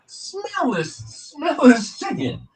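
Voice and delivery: very high, stretched-out insistence; acted indignant demand. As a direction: re-enact an incredulous demand, drawn out. Acted demand to smell bad chicken.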